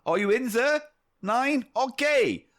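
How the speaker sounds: high, hammed-up German; German accent. German searcher at the next door in the script, German accent. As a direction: lift it into a comic German searcher. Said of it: "German accent".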